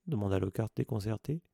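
plain reading voice

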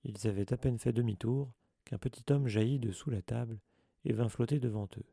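ordinary delivery

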